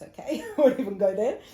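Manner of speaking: loud laugh, then brushes it off; a real burst of laughter before the aside. Real, not acted. Laughs off the misspelled name.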